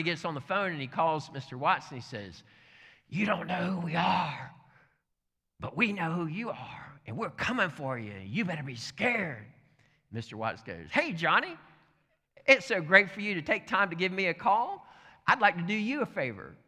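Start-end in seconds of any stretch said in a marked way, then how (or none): 3.1-9.5 put-on threatening phone voice, raised in pitch; hammed-up spooky intimidation; menacing caller. Acted threatening anonymous phone call. 10.9-16.6 high, loud, delighted phone voice; acting out unbothered friendliness; cheerful neighbor. Acted cheery reply to threat.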